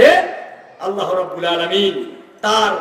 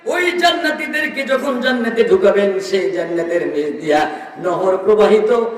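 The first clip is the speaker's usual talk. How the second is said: high chanting sermon delivery; fervent preaching; 7 semitones higher than usual. Real, not acted. Preacher relaying God's comfort to the Prophet, high fervent preaching.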